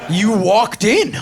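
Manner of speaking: slow, loud punchline; punchy mock yell. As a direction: hammer the punchline slowly.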